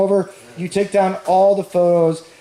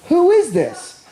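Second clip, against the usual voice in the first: high surprised voice; 11 semitones higher, slower than usual. Retold or imitated. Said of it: "and they say".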